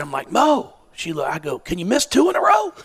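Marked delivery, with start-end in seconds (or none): 0.3-0.7 calling her name high and loud across the court; yelled name. Retold or imitated. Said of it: "So I yelled out there". 1.6-2.7 high, loud teasing call to the free-throw line; yelled joke. Retold or imitated. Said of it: "So I yelled out there".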